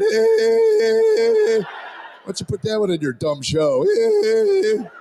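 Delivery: squeaky high hey, then back down; unbroken voice. Retold or imitated. Son mocks dad's show with a squeaky high hey. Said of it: "This man-child's voice never dropped".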